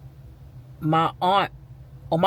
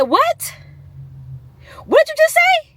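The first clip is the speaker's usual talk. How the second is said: outraged disbelief; 4 dB louder than usual. Retold or imitated. Outraged at aunt's remark.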